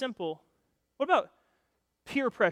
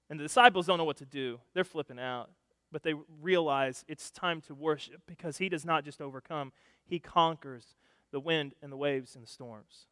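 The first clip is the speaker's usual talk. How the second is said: ordinary talk, nothing marked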